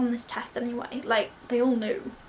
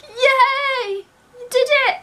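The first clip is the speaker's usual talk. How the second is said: high, loud celebration; retold cheering; 12 semitones higher, 10 dB louder, much slower than usual. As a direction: imitate a proud dad whooping down the phone. Dad's celebration on phone retold.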